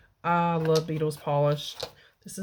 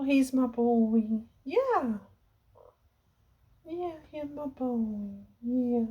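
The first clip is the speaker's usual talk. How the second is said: cooing at a pet; 6 semitones higher, slower than usual. Real, not acted. Owner cuddling Pedro on camera, slow cooing baby talk. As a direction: dote on him, stretch the words.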